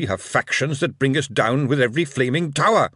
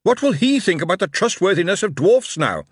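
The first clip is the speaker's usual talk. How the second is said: starts even, rises to a loud, high shout; from measured news to angry address; calm turning angry; 8 semitones higher, 4 dB louder than usual. A narrator's reading. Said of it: "He raised his voice and shouted".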